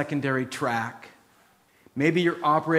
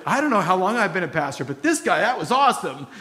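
loud, fast, higher burst of amazement; excited praise; 5 semitones higher, 5 dB louder, faster than usual. Retold or imitated. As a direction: gush about your friend, loud and high. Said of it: "And I went".

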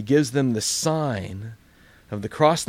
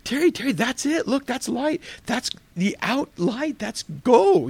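much higher, urgent calling out; excited pleading; 10 semitones higher than usual. Retold or imitated. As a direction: beg the friend to see the light. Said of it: "And I go".

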